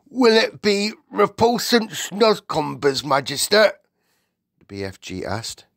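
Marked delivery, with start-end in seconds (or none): 0.1-3.7 high, loud giant's question; naive giant. Acted. BFG asks about breakfast, loud and earnest. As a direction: ask it earnestly in a big raised voice. Narrator voices worried giant.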